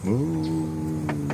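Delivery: low cow moo. Shown as a demonstration. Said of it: "That's the way the cow goes".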